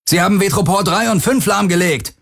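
high, urgent shout with a hint of panic; panicked call over noise. A narrator's reading. Captain reporting damage amid sirens, panic creeping in. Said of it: "rief Akina über den Lärm".

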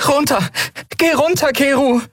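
high, panicked pleading; breathless begging. Acted. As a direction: beg him desperately to land. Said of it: "Atemlos bettelte sie". (atemlos flehend, hoch)

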